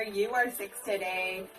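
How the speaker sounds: low puppet voice. Acted.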